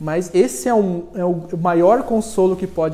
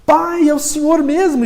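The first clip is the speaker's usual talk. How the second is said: excited reunion; 8 semitones higher, 5 dB louder than usual. Acted. Pinocchio finds his father Gepeto.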